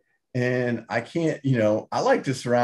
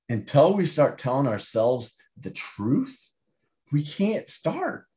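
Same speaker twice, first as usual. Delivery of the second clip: usual speaking voice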